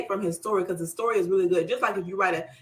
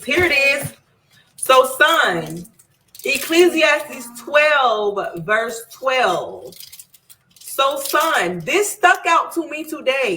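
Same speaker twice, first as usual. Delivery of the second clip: higher and louder, eager; excited; 6 semitones higher, 6 dB louder, much slower than usual. Real, not acted. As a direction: burst out with excitement. Excited about found verse.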